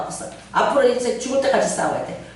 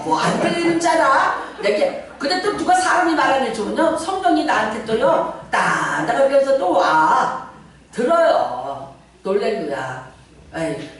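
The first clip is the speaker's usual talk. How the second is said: ordinary talk, nothing marked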